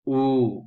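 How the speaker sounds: U loud and long with pouted lips; rounded vowel. Shown as a demonstration. Teacher showing pouted mouth for dommah, fully articulated. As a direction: pout firmly for a full U. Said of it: "mulutnya harus monyong".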